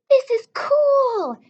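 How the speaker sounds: high, slow, thrilled cry; excited exclaim. A narrator's reading. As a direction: squeal it out in delight. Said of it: "exclaimed Lucy".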